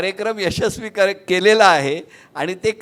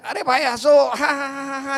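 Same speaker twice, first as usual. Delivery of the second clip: mock cheerleader shout; 6 semitones higher than usual. Floor manager urging the audience to laugh, high mock shout. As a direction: whip up fake laughter from a dull crowd.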